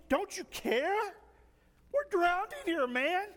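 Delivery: drawn-out, high-pitched whine; whining. Acted. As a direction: complain as if nobody is helping. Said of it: "start whining to Jesus".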